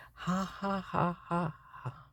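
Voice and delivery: quiet, hollow mock laughter; soft bitter ha ha; sarcastic fake laugh. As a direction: laugh to show it is not funny. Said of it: "That's how not funny this is".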